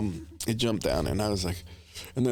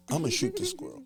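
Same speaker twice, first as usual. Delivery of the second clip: mock threat; 3 dB quieter, faster than usual. Retold threat, voice jumps high.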